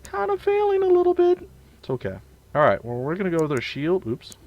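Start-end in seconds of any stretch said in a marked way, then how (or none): 0.0-1.5 squeaky much higher, louder falsetto; mock yourself in a squeak; self-mocking. Mocking his own bad talking.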